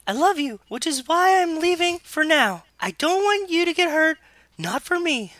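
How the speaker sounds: high put-on girlish voice; [name]'s falsetto. Younger sister explaining to her boyfriend, in high falsetto. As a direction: plead sweetly in falsetto.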